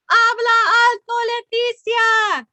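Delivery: high, loud call from the class; mock shout. A narrator's reading. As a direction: shout it like kids teasing. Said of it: "mis compañeros gritaron".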